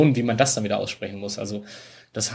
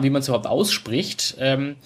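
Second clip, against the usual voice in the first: usual speaking voice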